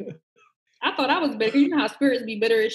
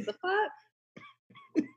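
incredulous squeal; 6 dB quieter than usual. Retold or imitated. Outraged retelling of Henny shots.

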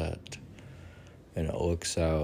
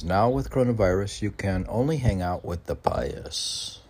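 raised, declaiming read of a short verse; poem reading; 5 semitones higher than usual. Acted. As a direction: read it out like a recital piece. Reads his own poem aloud.

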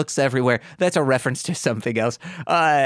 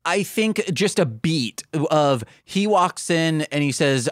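usual speaking voice

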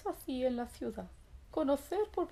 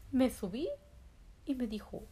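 ordinary delivery, nothing marked

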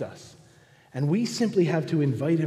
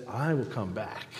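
lower, softer promise; lightly voiced, quiet reassurance; Jesus; 4 semitones lower, 6 dB quieter than usual. Jesus promising to return to the lonely, gentle low assurance. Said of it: "Jesus says".

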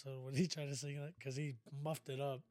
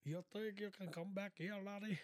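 higher, softer Irish attempt; Irish accent; 5 semitones higher, 6 dB quieter than usual. Taking a friend's request for Irish, soft Irish accent. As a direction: try a light, lilting Irish accent.

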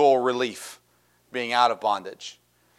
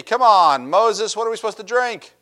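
complaining; 8 semitones higher, 5 dB louder than usual. Acted. Thirsty Israelites complaining.

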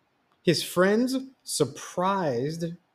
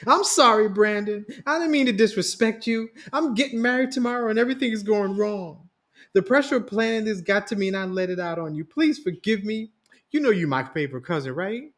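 acted crying; 7 semitones higher, 3 dB louder than usual. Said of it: "just started crying".

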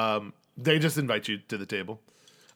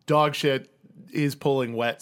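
usual speaking voice

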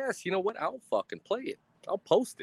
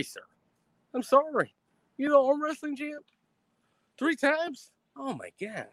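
mock scared; 6 semitones higher than usual. Mocking fear of arm wrestling champ.

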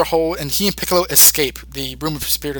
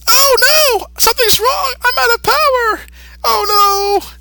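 very high, louder mock wail; hammed-up fake panic; 3 dB louder than usual. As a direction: overact a fake collapse. Gotenks fakes fainting.

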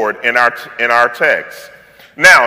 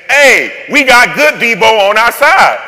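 high, loud excited call; hyped-up shout of good news; excited boast; 7 semitones higher, 6 dB louder than usual. Retold or imitated. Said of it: "what Moses and them is saying is".

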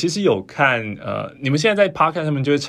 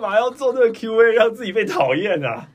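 mock whiny complaint; 10 semitones higher than usual.

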